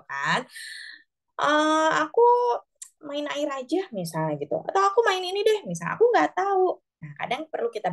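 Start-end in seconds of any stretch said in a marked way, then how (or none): 1.4-3.9 lightly voiced child, pitched higher; a hint of a small kid suggesting an idea; mild childlike voice; child's voice. Parent voicing child's idea. 4.6-5.6 put-on much higher, childlike offer; voicing a little child, pitched up; child's voice. Parent voicing child's idea. 6.0-6.7 put-on high, childlike "I don't know"; be a bored kid who has no idea; pitched-up child voice; unsure child. Parent voicing unsure child.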